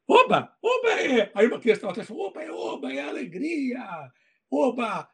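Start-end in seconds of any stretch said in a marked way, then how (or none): 0.1-1.3 much higher, joyful "Oba!" burst; excited exclamation. Retold or imitated. Author answering a shy child with a gleeful cry. 2.3-5.1 put-on soft, slow, half-whispered child explaining; a hint of a small child, breathy and unhurried; lightly voiced child. A child behind him explaining, softly voiced.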